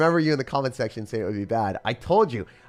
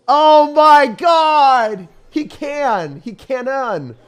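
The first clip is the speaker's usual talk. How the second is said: much higher, louder, stretched wail; exasperated cry; 13 semitones higher, 11 dB louder, much slower than usual. Real, not acted. Gamer wailing as the opponent's play lands, exasperated cry. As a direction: wail at the screen.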